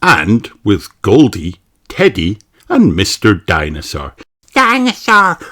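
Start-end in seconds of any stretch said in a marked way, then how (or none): 4.5-5.4 put-on high toddler cry of one word; George's voice. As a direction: squeal it like a tiny boy. Little brother shouts his toy.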